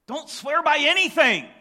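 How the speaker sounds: "Don't swear by anything" high and loud, "anything" punched; booming pulpit command. Shown as a demonstration. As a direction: preach it out full force. Preacher restates Jesus' command.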